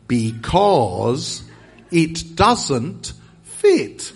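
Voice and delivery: very slow, loud, word by word; over-enunciate as if they don't understand; talking to foreigners. Acted. Englishman explaining to a foreigner, slow and loud. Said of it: "the way we English speak to foreigners".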